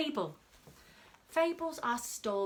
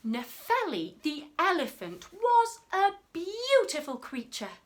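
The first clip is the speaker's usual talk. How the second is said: ordinary delivery, nothing marked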